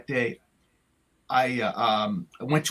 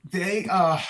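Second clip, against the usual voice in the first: ordinary talk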